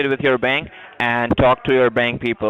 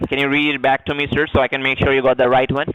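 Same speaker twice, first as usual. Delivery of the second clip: ordinary talk, nothing marked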